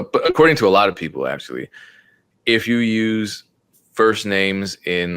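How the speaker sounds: ordinary delivery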